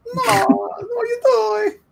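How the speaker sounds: very high, drawn-out cartoon wail; slow squealed mock Italian protest; fake Italian voice. Shown as a demonstration. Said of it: "fake Italian voice".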